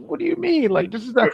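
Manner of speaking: high, incredulous mock question; imitating a startled guy who can't believe it; mock disbelief. Retelling Mark's reaction to the bird god, mock shocked.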